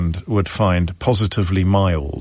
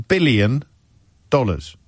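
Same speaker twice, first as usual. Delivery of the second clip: ordinary talk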